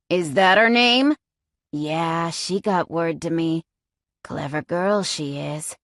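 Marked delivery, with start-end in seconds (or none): 1.7-5.8 cold, taunting captor; menacing villain. A narrator's reading. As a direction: threaten her with quiet relish. Villain Jasper taunts captive.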